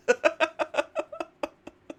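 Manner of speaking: squealing helpless laugh; high breathless giggle; high giggle fit. Real, not acted. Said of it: "I'm not laughing at the idea".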